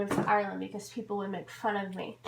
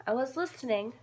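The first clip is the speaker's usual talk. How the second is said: usual speaking voice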